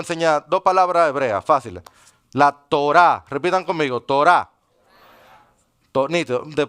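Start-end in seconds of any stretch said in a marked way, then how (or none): 2.3-3.3 Torá with the stress on the final A; final-syllable stress. Shown as a demonstration. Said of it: "Termina con acento en la A". 4.0-4.5 Torá stressed on its last A; Torá as a repeat-after-me model, accent on the end; final-syllable stress. Shown as a demonstration. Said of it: "Termina con acento en la A".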